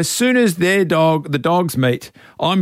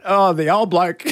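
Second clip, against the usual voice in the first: usual speaking voice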